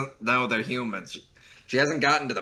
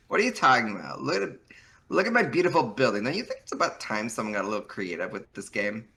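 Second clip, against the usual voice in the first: put-on Valley Girl voice; 5 semitones higher, 3 dB louder than usual. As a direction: play a smug Valley Girl. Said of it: "You gave her a very good Valley Girl voice".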